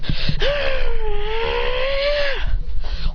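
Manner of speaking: high, sustained attacking cry; long battle yell. Acted. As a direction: hold a high fight yell through the blow. Long yell while striking in fight.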